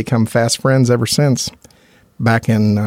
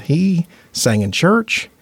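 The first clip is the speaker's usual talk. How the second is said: ordinary talk, nothing marked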